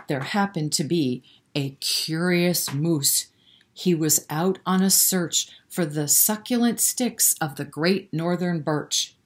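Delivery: ordinary delivery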